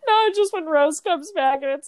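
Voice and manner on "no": ordinary delivery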